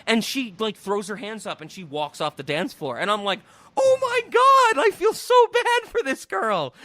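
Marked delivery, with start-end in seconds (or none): 3.8-6.7 high, loud, pained outburst of pity; exaggerated anguish on someone's behalf; distressed sympathy. Viewer feeling bad for the girl, distressed. Acting own pitying reaction to movie.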